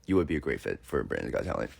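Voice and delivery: deep fast caller voice. Retold or imitated. Caller hanging up after a quick verdict, low and fast. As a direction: rattle it off low and dry. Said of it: "They were like".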